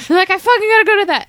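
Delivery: high and fast, thrilled; excited. Retold or imitated. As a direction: gush about it eagerly. Excited about band signing.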